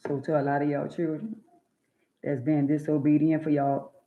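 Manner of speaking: normal talking voice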